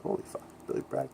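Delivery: fast, hushed whisper of disbelief; awed whisper. Retold or imitated. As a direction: whisper it to yourself, starstruck. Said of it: "and I was like".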